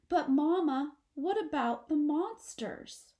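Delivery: high, loud fearful wail; half-wail in fear; frightened child calling out. A narrator's reading. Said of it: "She half wails".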